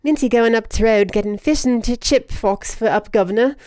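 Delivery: hammed-up British accent. Streamer retrying a chat message, put-on British accent. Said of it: "I guess it's supposed to be".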